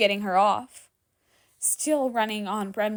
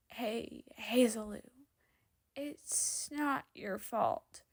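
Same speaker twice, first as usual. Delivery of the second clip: much softer, slow and gentle reassurance; softened, soothing; 7 dB quieter, slower than usual. A narrator's reading. Danny comforting the crying girl, gentle and soft. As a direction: soothe her like a plush blanket. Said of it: "softens it as much as he can".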